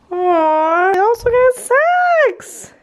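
excited. Real, not acted. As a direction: coo over the gifts. Gushing over gifts.

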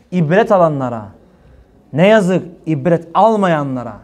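ordinary delivery

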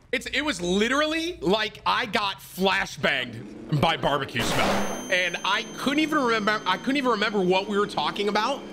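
high-pitched, animated insistence; worked-up retelling. Real, not acted. Streamer telling chat how a barbecue smell stopped him, worked-up retelling.

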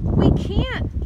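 high, loud cry of dismay; alarmed gasp. A narrator's reading. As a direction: protest in sudden alarm. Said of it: "gasps Frog".

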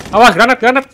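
loud urgent warning shout; grenade warning yell. Real, not acted. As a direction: yell the warning fast to teammates. (teriakan peringatan granat)

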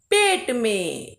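पेट में said very slowly; slow answer. Shown as a demonstration. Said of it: "बताओ जल्दी से?". (धीरे से पेट में)

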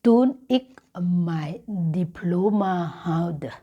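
each word drawn out slowly for learners; slow Dutch model sentence. Shown as a demonstration. Teacher reading a Dutch example to Thai learners, slow. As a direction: read the example slowly and carefully. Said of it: "ดิฉันก็ขึ้นคำว่า".

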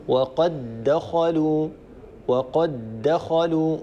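وقد دخلوا said slowly as waqaddakhalu with one doubled dal; merged doubled dal. Shown as a demonstration. Teacher showing students the merged dal in وقد دخلوا. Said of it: "فيصير النطق بدال مشددة".

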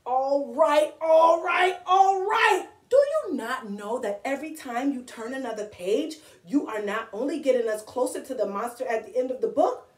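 exasperated Grover. Acted. Grover scolds the reader for turning pages, exasperated. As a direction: start worked up and climb to a high cry.

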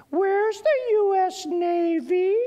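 put-on very high, slow, loud child's shriek; high-piercing toddler voice. Three-year-old interrupting dad's aircraft explanation, high-piercing voice. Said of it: "you hear this high-piercing voice".

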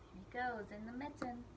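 ordinary delivery

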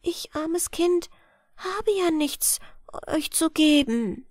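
put-on high, slow timid child voice; anxious. A narrator's reading. Poor girl admits to the boatmen she has nothing, anxiously. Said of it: "und sie sagte ängstlich".

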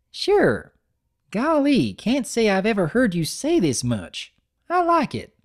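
high, happy little filly; delighted filly. Acted. Apple Bloom pleased at her brother, high happy filly voice. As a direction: squeal with childish delight.

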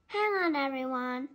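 a touch of yelling to the crew; mild yell. A narrator's reading. Kamer to everyone in the tossed boat, mildly yelling. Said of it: "yelled Kamer".

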